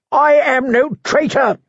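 high screeched denial; screeching. A narrator's reading. Prisoner screeching at Sir Axel, high and shrill. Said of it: "screeched the prisoner".

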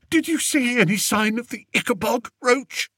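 high, fast fearful question; whimpering. A narrator's reading. Scared king asking Captain Roach, whimpering. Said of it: "whimpered Fred".

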